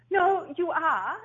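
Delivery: put-on slow, firm Dutch-accented father. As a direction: lay down the law slowly in a Dutch accent. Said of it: "thick Dutch accent".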